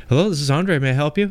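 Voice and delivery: light phone-greeting clerk voice; customer service voice. Retold or imitated. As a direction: answer the phone like a polite clerk. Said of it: "your customer service voice when you answer the phone".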